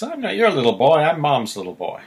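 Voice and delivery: "your" rising high and loud; stress on your. Retold or imitated. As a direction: lean on "your", then land on "Mom's". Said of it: "He says".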